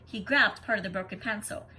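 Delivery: usual speaking voice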